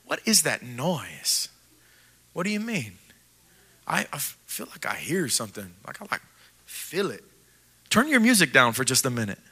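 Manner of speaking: low, hushed, uneasy murmur; quiet, nervous Israelites hearing rumbling; hushed worried voices. Acted. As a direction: whisper it as if something is coming. Israelites hear a distant noise.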